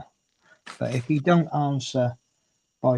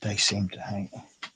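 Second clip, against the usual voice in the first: ordinary delivery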